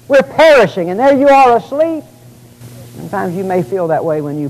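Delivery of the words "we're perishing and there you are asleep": frightened disciples' protest. Retold or imitated. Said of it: "and they were frightened".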